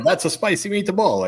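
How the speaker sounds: mock Italian line. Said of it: "I just".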